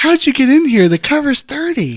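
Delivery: high put-on stripper voice; man attempting a high female voice. Stripper challenging a broke customer, high put-on voice. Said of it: "You still sound like a man".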